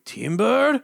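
loud lumberjack call; yelling. Retold or imitated. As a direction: call out timber at full voice. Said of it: "they were actually going".

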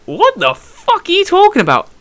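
high, drawn-out disbelief; incredulous. Retold or imitated. Fighter hearing his opponent claim a knee, incredulous. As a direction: stretch it out high, utterly baffled.

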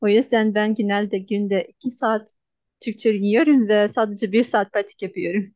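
ordinary delivery

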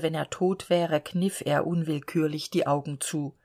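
plain reading voice

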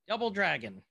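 ordinary talk

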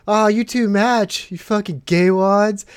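slow, loud, sneering taunt from a passing truck; mocking jeer. Guy in a truck taunting two cadets, slow loud jeer. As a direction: drag it out and jeer at them.

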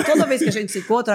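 ordinary talk

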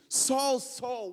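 loud, high calling of a name; voice from heaven. A narrator's reading. Preacher reads God's call to Saul, loud heavenly voice. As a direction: call out like God from the sky. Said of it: "heard a voice saying unto him".

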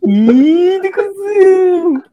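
high drawn-out shocked cry; shocked exclamation. Real, not acted. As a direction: gasp it out, scandalized. Reação chocada à história. (exclamação chocada e arrastada)

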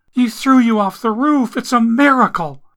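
high, loud sobbing voice; sobbing woman amazed he survived; tearful amazement. Acted. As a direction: gush through tears.

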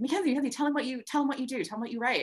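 high, insistent nudging; eager urging. Retold or imitated. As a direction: push her friend to speak. Friend urging Mackenzie.

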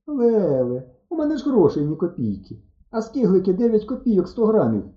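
high-pitched alarmed realisation; sudden dismay. A narrator's reading. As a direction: voice jumps up as panic strikes. Sudden realisation he has no money. (стривожено, спохопившись)

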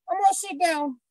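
put-on high, childlike puppet voice; squeaky little baby voice; baby gorilla voice.

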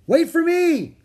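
high, urgent holler; piercingly high shout; loud voice. A narrator's reading. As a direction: call out loudly to stop someone leaving. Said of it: "came a loud voice".

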